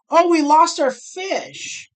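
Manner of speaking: sudden high, loud, slow dismay; surprised outcry. Real, not acted. Streamer reacting to the fish leaving, surprised outcry. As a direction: blurt out the loss of the fish. Fish leaves the poker table.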